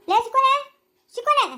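calling out. Acted. Calling out to someone away.

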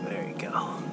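near-whisper, very soft and low; hushed aside. Real, not acted. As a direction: murmur it softly while handling a fish. Handling the fish gently.

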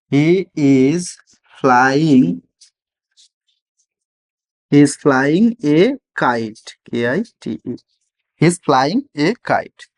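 he is flying a kite drawn out word by word; slow teacher dictation for beginners. Shown as a demonstration. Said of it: "তাহলে কি বলবো এটা?".

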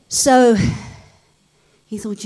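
usual speaking voice

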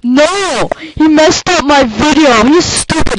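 furious shout at the friend; angry outburst. Real, not acted. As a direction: yell at the friend who ruined it. Friend ruined his video.

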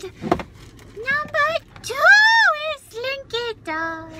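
high, drawn-out, singsong announcement; loud, high ta-da on each stretched word; excited reveal. Real, not acted. Shopper showing off a new find to viewers, excited reveal.

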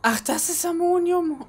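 high, loud shocked realization; surprised shout. Real, not acted. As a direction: it suddenly dawns on you, cry it out. Realizes own mistake, shouts. (überraschter Aufschrei)

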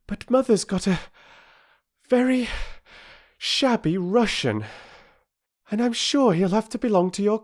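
put-on high, hurried young girl voice; a girl blurting out news to the doctor; urgent girl.

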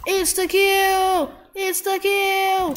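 high, drawn-out power-up cry; slow, high triumphant holler; excited call-out. Real, not acted. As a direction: sing out each one high and long. Calling out a game power-up.

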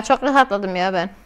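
normal talking voice

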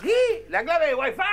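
puzzled shout. Retold or imitated. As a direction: bark it back, not understanding. Waiter shouting back puzzled. (mozo confundido gritando qué)

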